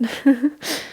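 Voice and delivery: short embarrassed chuckle; a quick sheepish laugh. Real, not acted. Laughing at own hiccups.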